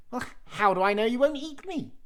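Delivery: very high, loud, wary; high suspicious cheese man. Acted.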